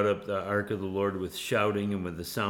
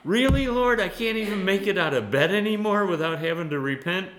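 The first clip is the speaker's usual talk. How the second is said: exasperated complaint; 12 semitones higher, 9 dB louder than usual. Retold or imitated. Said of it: "It was just like".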